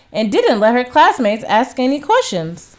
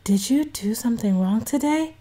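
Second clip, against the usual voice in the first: hushed, softer classroom whisper; 7 dB quieter than usual. A narrator's reading. Classmate Sasha whispering to Bella in class, hushed. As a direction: whisper it so the teacher won't hear. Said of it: "Sasha whispered".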